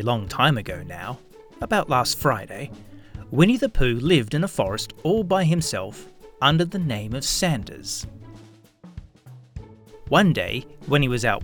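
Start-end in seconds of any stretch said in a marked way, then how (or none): none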